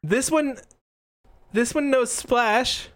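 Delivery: put-on high, slow, hesitant child's voice; dejected kid trainer. Young trainer telling referee his Pokemon's move, dejected. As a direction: admit it slowly in a high little voice.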